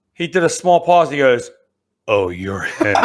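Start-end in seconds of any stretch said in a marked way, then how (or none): 2.1-3.0 lower, knowing instructor voice with a laugh; mock instructor. Ranger instructor to the trainee, knowing and amused. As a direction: play the instructor who just recognized him.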